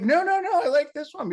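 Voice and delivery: hammed-up high-pitched denial; mock squeaky protest. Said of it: "It's like".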